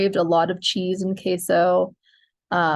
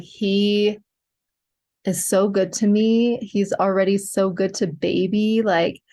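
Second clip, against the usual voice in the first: ordinary delivery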